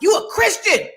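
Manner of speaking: mock a preachy father scolding; imitating her dad. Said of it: "he was like".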